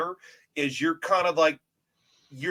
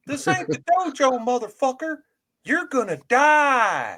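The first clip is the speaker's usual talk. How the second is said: high tough-guy threat, slowing on the end; Max DeVille impression; 9 semitones higher than usual. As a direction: snarl it up high, then drag out the last words. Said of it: "that is a Max DeVille impersonation".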